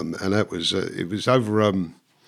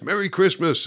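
mock festive greeting; 7 semitones higher than usual. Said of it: "and all that".

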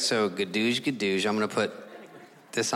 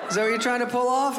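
high, incredulous challenge; mock disbelief; 10 semitones higher than usual. Doctor challenging the patient's one forty, incredulous. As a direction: call out an obvious fib. Doctor doubts the claimed weight.

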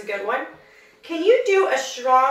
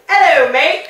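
jokey put-on British accent, louder; mock British accent; 4 semitones higher, 9 dB louder, slower than usual. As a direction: overdo a cheery British greeting as a joke. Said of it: "nobody sounds like that".

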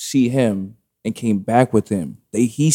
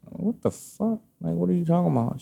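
high, hushed incredulous questioning; retold disbelief; 7 semitones higher, 6 dB quieter than usual. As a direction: question it in soft, high-pitched shock. Retold reaction to news of ex.

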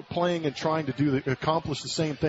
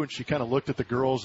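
usual speaking voice

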